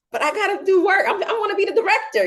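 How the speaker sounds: high, fast, laughing protest; whiny protest. Retold or imitated. Her protest to God about having to rest, high, fast and laughing. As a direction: whine it like a kid begging to keep working. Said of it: "And I'm like".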